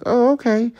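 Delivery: very high squeaky mock-innocent reply; clueless okay. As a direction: pitch it way up, playfully oblivious. Acting out own reaction alone.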